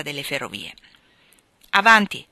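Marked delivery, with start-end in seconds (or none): 1.7-2.3 loud, gruff single-word command; brusque. A narrator's reading. Old Lawrence answers the knock at his door, brusque. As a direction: call it out curtly. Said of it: "più brusco del solito".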